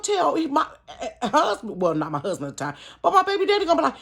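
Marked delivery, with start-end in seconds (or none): none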